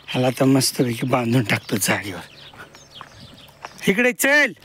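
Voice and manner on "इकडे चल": high call to hurry the calf along. Real, not acted. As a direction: tell the calf to hurry up and come. Calling the calf home.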